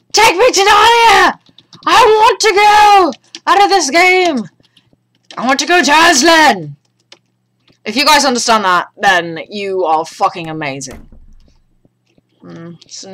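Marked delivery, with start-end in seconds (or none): 0.1-6.8 high, loud, stretched-out plea; mock desperate cry. Player begs wardrobe to reach Narnia, hammed-up loud plea. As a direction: beg the wardrobe like a fantasy fanatic.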